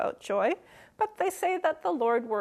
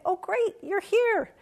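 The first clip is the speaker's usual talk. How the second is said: breezy brush-off; 9 semitones higher than usual. Retold or imitated. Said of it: "I'd just say".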